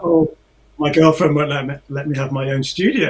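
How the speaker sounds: usual speaking voice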